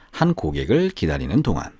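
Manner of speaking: plain reading voice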